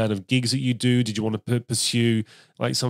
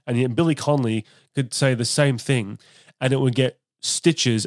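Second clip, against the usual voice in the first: normal talking voice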